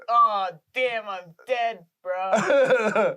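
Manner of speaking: loud, drawn-out mock realization of being dead; hammed-up dismay, projected loudly; mock dismayed ghost. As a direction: groan it out like a comic ghost. Imagined first thought on dying.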